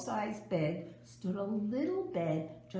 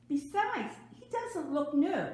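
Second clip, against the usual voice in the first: mother's reply; 8 semitones higher than usual. Acted. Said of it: "her mother sighed".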